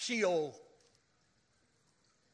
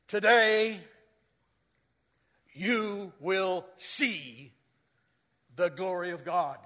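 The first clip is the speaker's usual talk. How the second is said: solemn proclamation; 5 dB louder, slower than usual. Retold or imitated. Jesus proclaims to the crowd.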